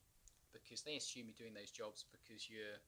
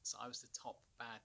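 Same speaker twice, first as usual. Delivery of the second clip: ordinary delivery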